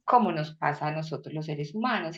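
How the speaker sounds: ordinary talk, nothing marked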